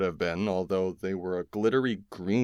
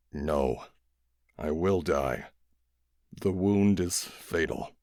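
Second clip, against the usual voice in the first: lightly lower, calm alien voice; dying alien; 3 semitones lower, slower than usual. A narrator's reading. Dying alien answering the kids, low and weary. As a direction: speak slow and weary as a dying being.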